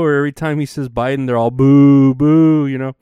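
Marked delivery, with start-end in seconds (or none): 1.5-2.7 crowd booing. Retold or imitated.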